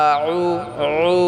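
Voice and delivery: আউ with the ain pressed from the throat. Shown as a demonstration. Teacher shows students the throaty ain in আউ. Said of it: "আইন গলায় চাপ দিয়ে উচ্চারণ করতে হবে".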